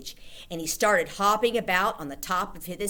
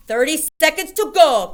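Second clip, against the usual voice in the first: high, loud witch countdown; witch crying out; 10 semitones higher, 12 dB louder than usual. A narrator's reading. Grand High Witch counting down to the audience. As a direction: cry it out gleefully. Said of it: "cried the Grand High Witch".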